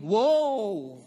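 light offhand woe, shrugged off; casual woe. Shown as a demonstration. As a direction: toss off "Woe!" like it's nothing. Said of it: "Hindi ito yung".

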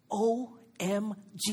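slow, drawn-out mock OMG. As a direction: spell out O M G slowly, mock-amazed. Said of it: "Hindi niya sinabing".

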